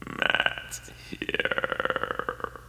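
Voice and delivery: eerie, drawn-out whisper; deadpan whisper. A narrator's reading. Creepy girl tells the narrator out of nowhere, deadpan whisper. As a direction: whisper it slowly and tonelessly, stretching the last word. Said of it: "deadpan voice, she said".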